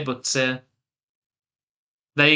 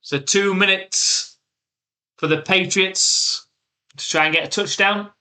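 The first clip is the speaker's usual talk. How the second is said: slow, raised broadcaster set-up; dramatic commentary build-up; 4 semitones higher than usual. Acted. Streamer framing the Patriots' final drive for chat, broadcaster style. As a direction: draw out the late-game stakes like TV commentary.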